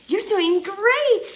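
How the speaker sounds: ordinary delivery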